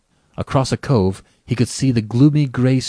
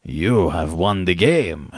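slow, low character voice of the general; smiling general; 3 semitones lower, slower than usual. A narrator's reading. General concedes to his quarry, slow and smiling. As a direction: concede slowly, with a low, composed smile. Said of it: "sucked in his breath and smiled".

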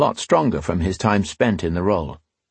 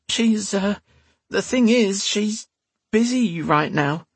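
high-pitched, halting whine; whiny serving maid making excuses; 12 semitones higher, slower than usual. A narrator's reading. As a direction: whine it high, stalling nervously. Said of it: "she said in a whiny voice".

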